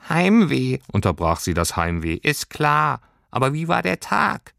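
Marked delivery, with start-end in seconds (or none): 0.1-0.8 high, louder little creature voice; Heimweh cuts in. A narrator's reading. Heimweh interrupts Lilly. 2.2-4.5 high, tiny creature voice; a small homesickness sprite speaking up; little Heimweh voice. A narrator's reading. Heimweh asks about day.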